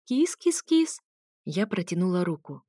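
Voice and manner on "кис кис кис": much higher coaxing kitty call. A narrator's reading. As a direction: lure the cat sweetly, high. Coaxing the cat. (ласковый высокий зов кошки)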